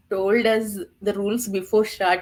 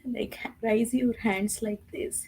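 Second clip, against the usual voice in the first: whispering; 7 dB quieter than usual. Real, not acted. Showing the raise-hand rule.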